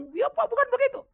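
imitated outburst, very high and loud; interrupting protest. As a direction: butt in with a high, loud objection. (menirukan orang menyela dengan protes)

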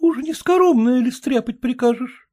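high sarcastic wife voice. Acted. Wife mocking husband's food rules, high sarcastic voice. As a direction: needle him as the wife.

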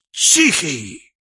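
a loud voiced sneeze. A narrator's reading. Unseen devil sneezes loudly over grandfather's head. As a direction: sneeze it out from above.

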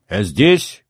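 mild shout in reply; understated answering call; shouting back. A narrator's reading. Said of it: "крикнул я в ответ".

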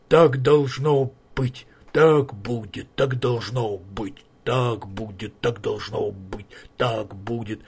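slow droning chant fading away; trolls droning. Acted. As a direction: drone it like a distant echo. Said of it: "как эхо, забубнили".

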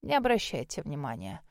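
low, soft brush-off; dismissive. A narrator's reading. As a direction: wave it away quietly, as nothing important. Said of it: "отмахнулся маг". (тихо, низко, отмахиваясь)

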